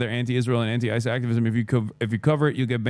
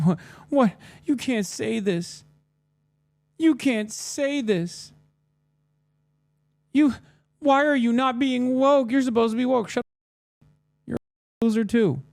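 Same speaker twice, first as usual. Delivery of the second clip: high, slow, mocking voice of an upset fan; mocking whiny fan; 11 semitones higher than usual. Fans whining at the streamer, high mocking voice. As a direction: whine it out like a triggered fan. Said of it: "They're like".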